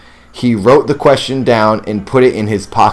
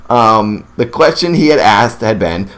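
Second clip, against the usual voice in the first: normal talking voice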